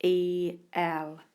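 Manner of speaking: letters E L called out clearly; name each letter as you write; spelling aloud. Shown as a demonstration. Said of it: "that sounds like a".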